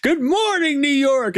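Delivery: high, bright falsetto call to the city; imitating Eddie Murphy's greeting. Friend imitating a movie prince greeting the city from a balcony. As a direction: sing out a morning greeting to New York. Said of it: "he goes".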